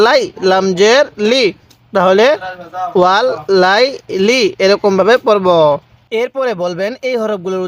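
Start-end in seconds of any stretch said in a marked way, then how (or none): none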